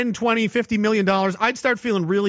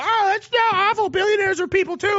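very high, whiny mock plea; mock whining; 14 semitones higher than usual. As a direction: whine on behalf of poor billionaires. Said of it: "they can whine and cry and go".

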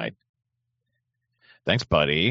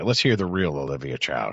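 normal talking voice

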